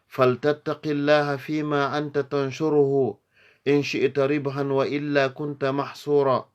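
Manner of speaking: loud, high, brisk verse delivery; Arabic poem recitation. Acted. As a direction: declaim each verse high and quick, pausing between lines.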